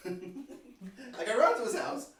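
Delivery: slow, laughing repeat; cracking up mid-line. Real, not acted.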